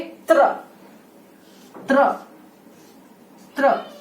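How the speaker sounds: त्र said crisply as half t plus r; sound of त्र. Shown as a demonstration. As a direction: repeat त्र clearly, t rolling into r. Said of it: "Next is, दो हो गए".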